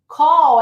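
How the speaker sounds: "call" loud and long, ending on a true L; "call" with tongue tip behind the front teeth; final L pronounced. Shown as a demonstration. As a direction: stretch "call" and land the L. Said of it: "é um L".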